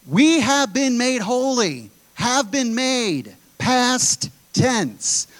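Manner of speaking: "have been made, past tense" slow and high, word by word; slow right down and lift "have been made, past tense" to drive it home; emphatic teaching. Real, not acted. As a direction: spell out "past tense" slowly, with weight. Preacher slows to stress the tense.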